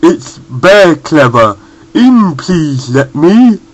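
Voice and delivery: slow, loud, scrambled words; slow, muddled and loud; nonsense voice. A narrator's reading. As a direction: jumble it slowly like a brainless bear. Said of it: "a voice that didn't make any sense".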